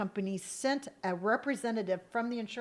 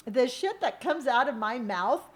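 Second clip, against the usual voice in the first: ordinary delivery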